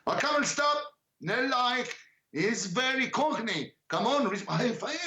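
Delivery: imitating the teacher's high teasing voice. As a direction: playfully mimic a singing teacher's insistence. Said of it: "She stay on phone".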